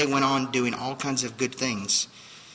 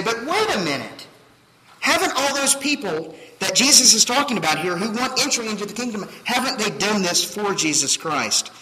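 high, loud protesting objection; a voiced listener's high, loud objection; objecting listener; 10 semitones higher, 6 dB louder than usual. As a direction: push back like a puzzled listener.

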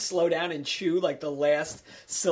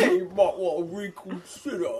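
put-on British warning, a bit higher; mock British accent; 4 semitones higher than usual. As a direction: warn like a polite London bobby. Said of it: "doing a really good British accent".

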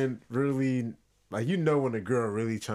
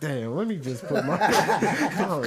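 mock apologetic guy; 5 semitones higher, 4 dB louder than usual.

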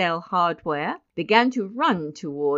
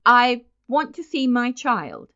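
raised, louder insistent request; 5 semitones higher, 5 dB louder than usual. Acted. Mister Button asking the nurse for his child, insistent. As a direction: state the demand firmly, pitched up. Nervous father asks the nurse.

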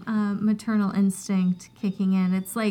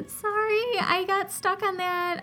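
high, singsong sorry; playful apology; 10 semitones higher than usual. Real, not acted.

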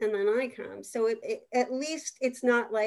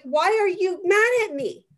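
put-on high, louder hurt child's question; daughter's voice; 8 semitones higher, 6 dB louder than usual. Daughter calling mom out when she loses it, high and hurt. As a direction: ask it like a stung little girl. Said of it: "she'll be like".

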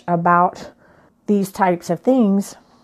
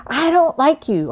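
kid's mock tantrum; 7 semitones higher than usual. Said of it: "they're gonna say".

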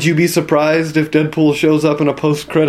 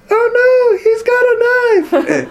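very high, slow squeal of fake fright; hammed-up terrified squeal; mock panic; slower than usual. Mock-scared quote in retold skit.

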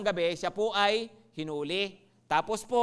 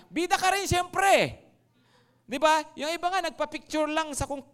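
excited; 8 semitones higher, 5 dB louder than usual. Real, not acted. Excited imagining being with Jesus.

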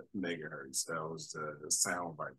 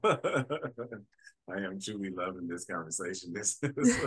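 real laughter breaking into the words; laughing delight; 3 semitones higher, 5 dB louder, slower than usual. Real, not acted.

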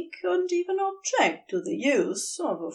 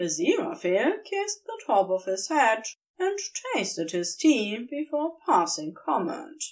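usual speaking voice

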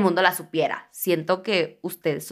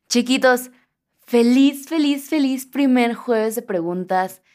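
higher, louder, bright greeting to listeners; excited announcement; 5 semitones higher, 6 dB louder than usual. Real, not acted. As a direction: announce the new show with joy. Announcing the new segment. (anuncio emocionado, más agudo y más fuerte)

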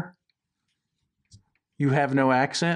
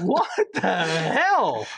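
drawn-out disbelief; 2 semitones higher, much slower than usual. Real, not acted. Reacting to Kyle Petty joke.

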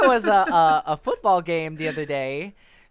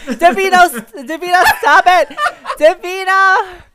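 high, loud mock scolding of Davina; half-laughing loud call of her name; playful yell; 13 semitones higher, 11 dB louder than usual. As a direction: shout her name like a fed-up parent, laughing. Playfully yelling at co-host.

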